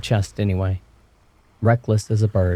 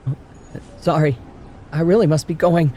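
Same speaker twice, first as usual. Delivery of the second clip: put-on higher, hurried girl's voice; blurted; 8 semitones higher than usual. A narrator's reading. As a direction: blurt it out and flee. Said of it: "I blurted".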